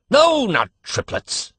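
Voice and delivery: high, loud, slow cutting reply. A narrator's reading. Doctor rebuffing the father's guess, cutting reply. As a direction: slice each word at him. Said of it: "answered the doctor cuttingly".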